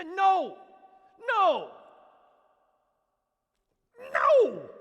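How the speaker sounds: loud, high, each no stronger; emphatic no. Real, not acted. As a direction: hammer every no harder. Preacher rejecting the idea.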